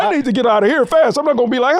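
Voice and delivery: ordinary delivery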